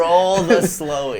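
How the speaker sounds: slow mock Aussie drawl; echoed Australian catchphrase. Friend echoing the funny slow-motion catchphrase, slow Australian accent. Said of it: "they just say it in the funniest".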